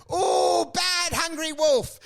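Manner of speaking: tut-tut like a cartoon parent; scolding cartoon voice. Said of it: "And he goes".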